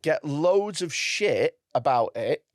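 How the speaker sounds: ordinary talk, nothing marked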